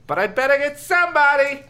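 high declaring character voice. Acted. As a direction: declare it firmly. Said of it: "Lefty declared".

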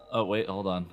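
ordinary delivery

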